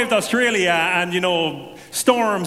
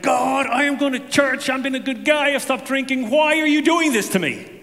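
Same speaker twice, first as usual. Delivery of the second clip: higher, indignant complaint to God; mild retold anger, pitched up; angry at God; 7 semitones higher than usual. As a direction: protest to God that it isn't fair. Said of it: "And I was mad".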